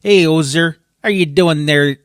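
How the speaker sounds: mock Canadian accent, called out loudly; Edmonton Canadian accent. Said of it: "They both say".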